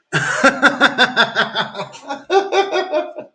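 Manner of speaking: long cackling laugh, pitched well up. Real, not acted. Cackling at own insult.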